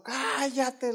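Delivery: high-pitched, held-back mock yell; imitated yell. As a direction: mimic a yell without full volume. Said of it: "con gritos".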